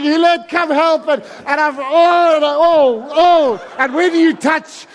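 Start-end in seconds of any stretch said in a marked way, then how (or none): 0.0-1.1 high, loud, drawn-out call; retold shout for help. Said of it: "and I'm shouting". 1.8-3.7 flustered cries. Retold or imitated. Flustered rescue acted out.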